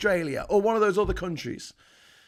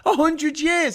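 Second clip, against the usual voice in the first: high, loud disbelief; incredulous outburst; 10 semitones higher, 6 dB louder, slower than usual. Real, not acted. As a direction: throw it back, louder and much higher. Incredulous repeat of quoted claim.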